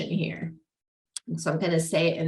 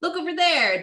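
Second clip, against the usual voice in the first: high chirpy mock distraction; lightly voiced parent pointing elsewhere; distracting parent; 8 semitones higher than usual. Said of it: "they'll be like".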